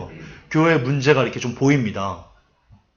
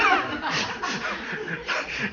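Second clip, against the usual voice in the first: real airy laughter; wheezing giggle; breathless laugh; 10 dB quieter than usual. Real, not acted. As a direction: let the laugh escape breathily. Laughs at own parody.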